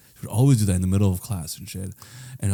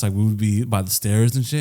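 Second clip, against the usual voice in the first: normal talking voice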